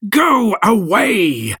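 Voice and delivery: high, drawn-out shout. A narrator's reading. Creator orders the monster away, high drawn-out shout. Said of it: "I shouted".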